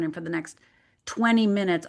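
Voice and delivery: normal talking voice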